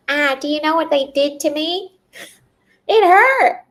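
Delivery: high mock-wounded whine, rising to a near cry; whiny hurt complaint. A healer mocking the patient's wounded whining. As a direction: play the sore patient, pitiful and loud. Voicing a hurt resentful self.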